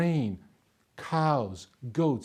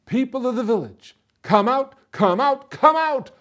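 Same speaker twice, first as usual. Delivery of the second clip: high, loud calling to a crowd; yelling out; 10 semitones higher, 7 dB louder than usual. A narrator's reading. Soldier on a bench rousing the village, yelling. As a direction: summon everyone from their houses. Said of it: "he yelled as loud as he could".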